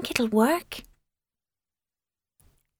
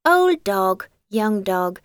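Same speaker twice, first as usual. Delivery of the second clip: usual speaking voice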